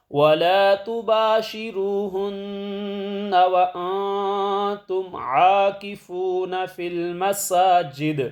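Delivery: solemn recited verse; Quran recitation. Acted. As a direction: chant the verse slowly and carefully.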